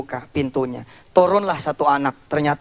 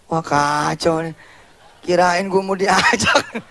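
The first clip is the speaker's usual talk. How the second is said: ordinary talk, nothing marked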